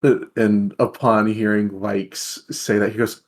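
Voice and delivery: ordinary talk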